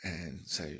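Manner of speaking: normal talking voice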